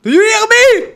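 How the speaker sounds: very high, loud call; shouting to be heard. Real, not acted. Gamer to an unhearing English player, shouted question. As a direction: yell across to someone who can't hear. Calling to player who cannot hear.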